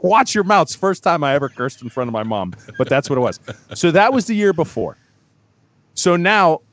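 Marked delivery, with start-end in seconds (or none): 0.0-0.6 mom scolding. Retold or imitated. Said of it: "She's like".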